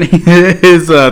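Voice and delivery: ordinary delivery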